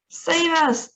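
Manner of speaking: high, loud cry for help; panicked yell. A narrator's reading. Grandmother crying for rescue as the lift shoots up, yelling. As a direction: scream for rescue. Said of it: "yelled Grandma Georgina".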